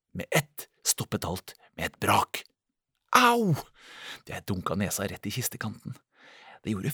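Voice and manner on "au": sharp, high, loud ouch; pained cry. A narrator's reading. Narrator bumps nose on chest, yelps in pain. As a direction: yelp as your nose hits wood.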